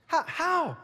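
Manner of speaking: high-pitched incredulous question; acted high squeak of disbelief; mock bafflement.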